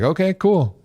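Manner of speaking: a hint of a laid-back Joe; casual Joe agreeing. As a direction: toss it off like it's nothing.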